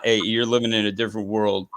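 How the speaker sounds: usual speaking voice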